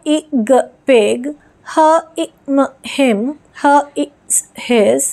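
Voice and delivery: teacher sounding out phonics; slow phonics blending for children; sound-by-sound blending demo. Shown as a demonstration. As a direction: say each letter sound apart, then join them into the word. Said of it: "इस तरह से आपको read कराना है".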